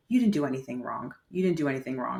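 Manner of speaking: low, gentle retold reassurance; reassuring. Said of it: "she started reassuring me".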